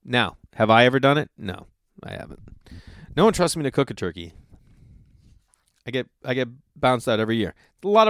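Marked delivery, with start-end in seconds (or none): 1.4-2.4 sheepish whisper; whispered no I haven't; whispered admission. Real, not acted. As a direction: admit it under your breath. Admitting never cooked turkey.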